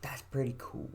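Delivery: soft whispered repeat. Pretend. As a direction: breathe it out, barely voiced.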